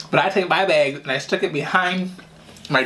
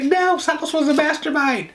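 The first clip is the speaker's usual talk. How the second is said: much higher, slow protest; sister denying; 13 semitones higher than usual. Retold or imitated. Sister to grandma, whining denial. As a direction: push the blame back. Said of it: "She's like".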